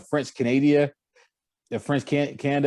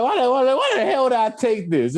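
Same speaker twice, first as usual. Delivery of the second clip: retold exasperation; 10 semitones higher than usual. Student regretting a French class, exasperated retelling. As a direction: replay your own baffled complaint, pitched up. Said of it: "I'm like".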